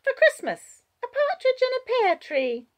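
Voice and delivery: put-on high, loud child explaining; Jania. A narrator's reading. Child explaining to groggy Wallace, high child voice. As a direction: announce it as a little girl. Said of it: "Jania is explaining".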